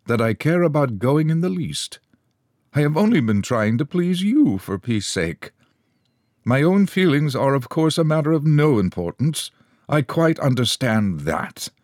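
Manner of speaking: high, loftily wounded tone; very dignified. A narrator's reading. Companion answering the waspish woman, very dignified. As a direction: play the offended gentleman with stiff dignity. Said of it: "in a very dignified voice".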